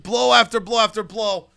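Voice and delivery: ordinary talk, nothing marked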